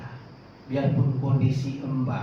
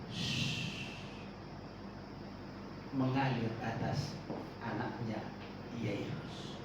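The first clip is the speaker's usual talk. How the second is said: hushed; 5 semitones lower, 13 dB quieter, slower than usual. Acted. Hushed describing life flowing.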